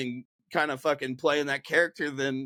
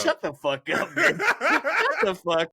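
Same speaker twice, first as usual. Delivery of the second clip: squeaky laughing street tough; Leroy voice laughing; 14 semitones higher, 3 dB louder, slower than usual. Pretend. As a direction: crack up while staying in character. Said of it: "he's completely degrading".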